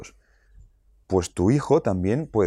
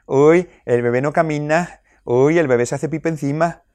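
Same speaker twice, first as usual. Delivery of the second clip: higher, louder taunting sing-song; mocking; 6 semitones higher, 4 dB louder than usual. Jealous three-year-old taunting the new baby, higher mocking sing-song. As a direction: tease the baby like a jealous big brother.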